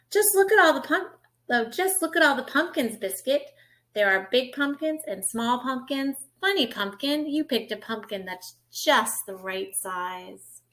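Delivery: bright, lilting picture-book read; reading to children. Acted. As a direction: read it like storytime for a toddler.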